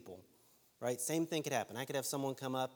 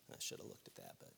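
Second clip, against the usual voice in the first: whispered aside; 11 dB quieter, faster than usual. Real, not acted. Aside while losing his place.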